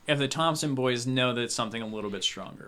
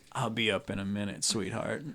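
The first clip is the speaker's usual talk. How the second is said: lecherous cowboy; 4 semitones lower, 7 dB quieter than usual. Acted. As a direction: promise her with a leer. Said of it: "And he goes".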